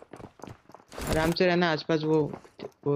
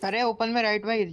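usual speaking voice

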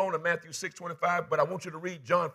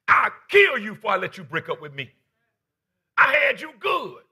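threatening, building to a shout; jealous lover who won't let go; menacing devil voice; 4 semitones higher, 9 dB louder than usual. Retold or imitated.

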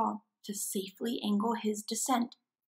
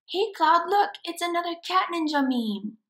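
put-on higher, quick excited kid; excited call; 8 semitones higher, 6 dB louder than usual. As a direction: shout to Claude about the meme.

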